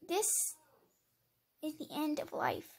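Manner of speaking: ordinary delivery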